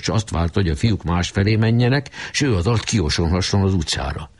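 usual speaking voice

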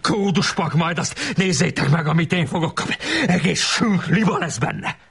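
high, strained schoolboy tirade; hammed-up indignant howling; acted raging rant. A narrator's reading. Böszörményi keeps raging after shouting.

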